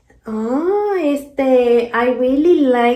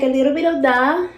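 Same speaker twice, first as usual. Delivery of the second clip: ordinary delivery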